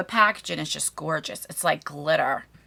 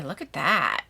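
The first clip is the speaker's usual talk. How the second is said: normal talking voice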